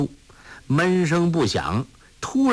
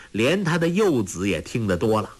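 usual speaking voice

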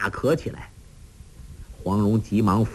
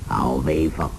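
put-on laughing old master; 7 semitones lower than usual. A narrator's reading. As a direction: jeer weakly in a breathy old man's voice. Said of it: "洪七公睁眼笑道". (老人气声讥笑)

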